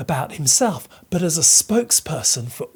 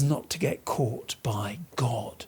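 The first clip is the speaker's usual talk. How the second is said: whisper; 7 semitones lower, 11 dB quieter than usual. Shown as a demonstration. Explaining secretive creeping.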